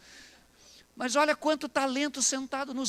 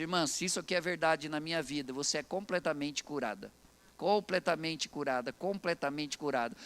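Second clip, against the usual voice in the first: low, measured healing declaration; solemn declaration; 8 semitones lower, 3 dB quieter than usual. Retold or imitated. Pastor declares healing over a sick woman, solemn and low.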